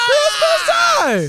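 high, slow sing-song shout; festive shout. Acted. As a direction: belt it out like a holiday jingle. Festive hype call.

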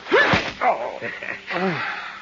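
short gloating thug's laugh; menacing chuckle. Acted. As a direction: laugh at the beaten man, pleased with yourself. Thug gloats over beaten detective.

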